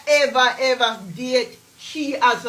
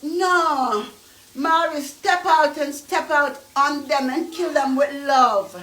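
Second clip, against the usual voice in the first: ordinary talk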